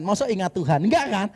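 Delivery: ordinary talk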